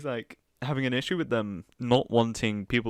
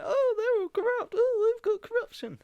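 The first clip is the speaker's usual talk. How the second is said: a squeaky, much higher mock of the candidate; 4 dB louder than usual. Streamer mocking the candidate as a whiny, high mock voice. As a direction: parody him in a silly high voice, louder. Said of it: "And it's like".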